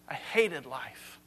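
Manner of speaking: low, soft, slow weary confession; quoting a man sick of living, subdued and slow; heavy despair. Acted. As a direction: let the words sink, low and drawn-out. Quoting Solomon's despair, first take.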